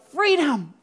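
"Freedom!" yelled high and loud like a battle cry; movie battle cry. Retold or imitated. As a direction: belt "Freedom!" like a war cry. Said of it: "he just yells".

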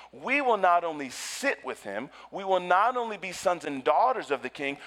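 loud, rising preacher's cry to the congregation; preaching at full force. Acted. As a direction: preach it out, building to reign with him. Preacher building to climax.

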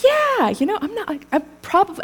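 high-pitched, slightly hesitant yeah; eager retold yes. Speaker answering her coworker on the sidewalk, high eager reply. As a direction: go up high on the yeah, then stumble on.

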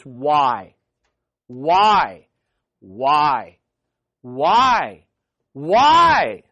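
low whys rising to a loud cry; building anguished whys. Acted.

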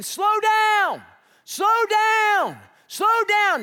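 high, loud dad's shout; screaming. Retold or imitated. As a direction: bellow it after runaway kids, stretching each word. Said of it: "and I'm screaming".

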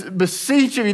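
usual speaking voice

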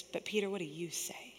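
softly, almost whispered; hushed question. Acted. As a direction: drop to a gentle, intimate hush. Jesus gently questioning Peter.

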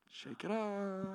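put-on brushing off the kid's jab with a high lilt; high sing-song. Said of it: "And I'm like".

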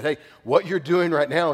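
loud, bold warning; bold prophet. Retold or imitated. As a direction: call them out boldly, like a prophet. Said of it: "would come in boldness".

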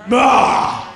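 loud, explosive "ugh"; big comic growl of frustration; frustrated groan. Acted. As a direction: blurt out pent-up exasperation. Second vented burst of frustration.

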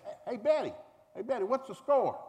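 high, loud call across the stands; a loud call to the church women behind the backstop. Retold or imitated. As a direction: hail Betty from the bench. Calling out to Betty.

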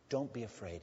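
Jesus comforting terrified disciples, very softly; gentle reassurance. Retold or imitated. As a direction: soothe frightened disciples softly. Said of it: "gently touching their shoulders".

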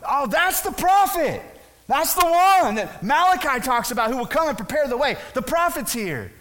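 high, loud exclamation of discovery; excited onlooker. Acted. One camp in the crowd spots the prophet, excited. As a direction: announce it loudly, pitched right up.

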